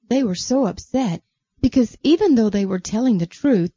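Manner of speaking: usual speaking voice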